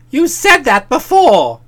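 loud, drawn-out protest; indignant interruption. Acted. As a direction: cut in loudly, stretching the words. Bertie interrupts a repeated reproach.

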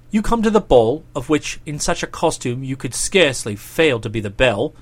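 brief him in a deep, chummy dialogue voice; low-voiced planning chap; Boko, laying out a plan.

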